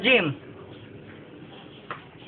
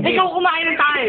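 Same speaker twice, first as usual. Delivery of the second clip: taunting yell; 10 semitones higher than usual. Real, not acted. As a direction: throw the dare back at him. Taunting back at the dare.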